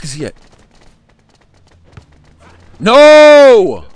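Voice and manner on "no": long, high, loud "No!"; real despairing yell; anguished shout. Real, not acted. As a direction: wail at the lost play. Losing the game.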